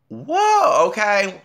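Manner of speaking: loud, high exclamation; startled whoa. Real, not acted. Reacting to the live cursing scandal, high startled whoa. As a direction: burst out high, then settle slightly.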